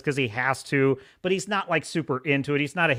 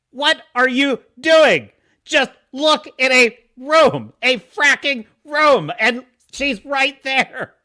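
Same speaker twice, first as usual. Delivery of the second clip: louder, higher, slow yelling at the screen; exasperated yell; 7 semitones higher, 6 dB louder, slower than usual. Retold or imitated. Yelling at the bad guys on the show, exasperated. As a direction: shout at the dumb goons. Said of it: "I'm like".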